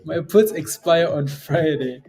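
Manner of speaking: drawn out, each word weighed; slow it right down for effect; slow, deliberate repeat. Real, not acted. Repeats it slowly.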